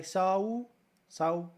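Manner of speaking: so stretched into a diphthong, Kentucky style; Eastern Kentucky drawl on so. Shown as a demonstration. Streamer demoes Kentucky vowels to chat, light drawl. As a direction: draw so out in a light Kentucky lilt. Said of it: "Kinda sound like this".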